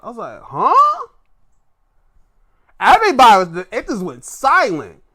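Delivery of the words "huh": high, loud, baffled yelp; acted-out disbelief; shocked. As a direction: shoot the pitch way up in stunned confusion. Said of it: "I sat there shocked".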